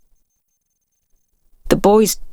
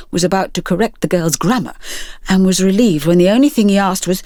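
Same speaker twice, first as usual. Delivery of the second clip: usual speaking voice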